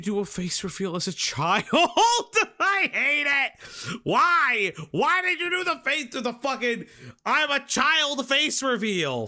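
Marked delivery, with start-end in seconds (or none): 1.7-9.3 high cringing laugh, shouting why; laugh-shout in disbelief. Real, not acted. Cringing laugh at face reveal.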